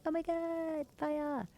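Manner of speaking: high put-on panicked cry; exaggerated high alarm; mock freak-out. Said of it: "everyone's freaking out".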